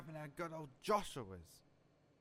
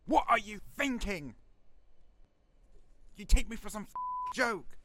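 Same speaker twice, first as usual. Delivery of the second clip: angry yelling leader; furious shout; 6 semitones higher, 9 dB louder than usual. Acted. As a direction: explode at the soldiers. Leader furious at his soldiers.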